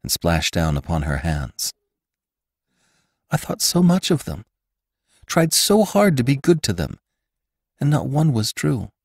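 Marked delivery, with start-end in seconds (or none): 3.3-8.9 tearful. A narrator's reading. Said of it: "hot tears well to her eyes".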